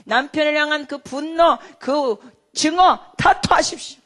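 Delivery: slow, rising to a shout; urging, building. Retold or imitated. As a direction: urge her to let it all out. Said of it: "제가 이렇게 토하는 거를 강조했어요". (분노를 다 토하라고 점점 크게 외침)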